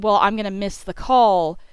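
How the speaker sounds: normal talking voice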